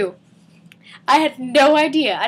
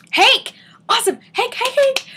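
high, loud bursts of excitement; excited calling out; 11 semitones higher, 5 dB louder than usual. Real, not acted. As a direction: call the name out in giddy bursts. Calls out excitedly to Hank.

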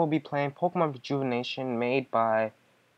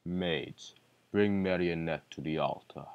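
deep villain voice; 8 semitones lower, 3 dB quieter than usual. Acted. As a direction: speak as a cold deep villain. Villain command.

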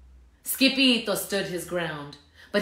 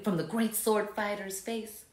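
usual speaking voice

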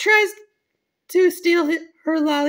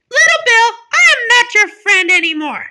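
raised, loud, hurt declaration; indignant rejection; 5 semitones higher, 5 dB louder than usual. Acted. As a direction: break off the friendship loudly. Victim ends the friendship.

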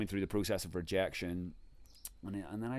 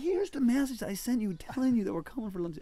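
high-pitched exasperated retort; retold exasperation; 13 semitones higher than usual. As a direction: insist with mock exasperation, voice pitched up. Retelling exasperation at guest house mix-up.

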